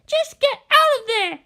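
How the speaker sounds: put-on very high, whiny girl's voice; pleading little girl. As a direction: beg him to come out.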